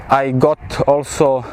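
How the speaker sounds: ordinary delivery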